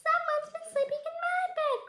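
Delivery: extremely high tiny cub voice; piping storybook cub cry; squeaky Baby Bear. A narrator's reading. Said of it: "in a squeaky little voice".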